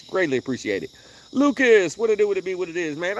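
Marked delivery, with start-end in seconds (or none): 1.3-3.2 catchphrase greeting. Acted. Repeated catchphrase greeting to viewer.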